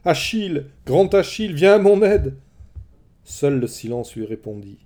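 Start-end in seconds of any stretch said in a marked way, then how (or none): 0.1-2.4 high, loud calls building to a shout; frightened call, rising in pitch and volume; desperate cry for help. Acted. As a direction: scream for Achilles to come save her. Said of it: "Alors elle cria, elle appela".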